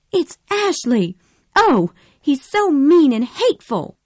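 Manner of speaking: high, loud tearful outburst; crying out upset. Acted. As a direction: wail the complaint high and loud. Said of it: "cried Scarlett".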